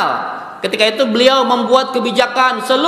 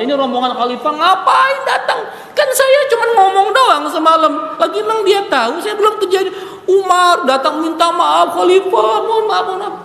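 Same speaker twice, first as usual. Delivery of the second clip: flustered high panic; 9 semitones higher than usual. Acted. The widow startled by the caliph's visit, flustered high panic. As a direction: play her caught off guard, panicking.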